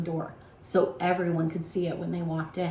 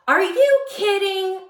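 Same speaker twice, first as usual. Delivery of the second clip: high, loud and slow disbelief; Tommy can't believe what he's hearing; high-pitched indignant cry to Darren; indignant outburst; 12 semitones higher, 8 dB louder than usual. A narrator's reading.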